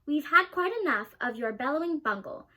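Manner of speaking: ordinary delivery, nothing marked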